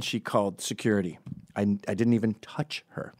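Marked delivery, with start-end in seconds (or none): none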